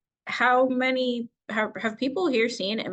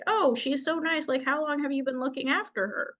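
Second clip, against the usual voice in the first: higher, lightly mimicked gushing stranger; 7 semitones higher than usual. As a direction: voice a cooing, oblivious onlooker in a raised pitch.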